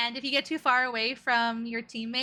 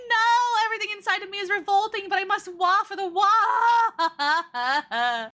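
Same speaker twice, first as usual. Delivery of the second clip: higher, louder, hammed-up tortured declaration; mock dramatic anguish; 8 semitones higher, 7 dB louder than usual. Reacting to her own old clip, torn but giving in, mock tragic. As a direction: ham it up like a tragic martyr, fast then dragging out the end. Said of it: "That was like".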